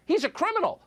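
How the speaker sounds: high-pitched hysterical imitation; shrill-pitched mocking accusation; mock outrage. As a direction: mimic a lynch mob baying for punishment. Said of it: "What C N N is telling us".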